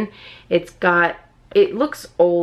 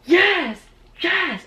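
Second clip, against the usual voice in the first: high-pitched triumphant yeses; real excitement; 11 semitones higher, much slower than usual. Real, not acted. Collector so excited about the pop won, high excited squeal. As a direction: squeal each yes with delight. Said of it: "I am so excited, you guys".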